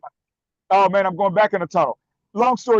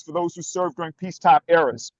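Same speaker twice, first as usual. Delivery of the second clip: normal talking voice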